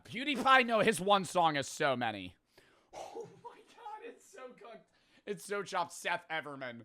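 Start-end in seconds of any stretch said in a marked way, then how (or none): 2.9-4.9 very high, faint squeak; tiny high, hushed lament; despairing whimper. Real, not acted. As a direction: squeak it softly in defeat. Despair after losing.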